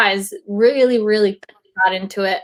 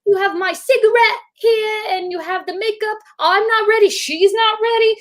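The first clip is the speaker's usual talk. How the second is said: Russell Crowe; 12 semitones higher than usual. Retold or imitated. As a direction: imitate a flustered star protesting to the director. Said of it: "Russell Crowe was like".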